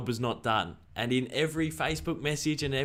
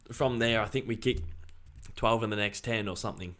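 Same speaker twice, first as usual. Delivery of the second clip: ordinary talk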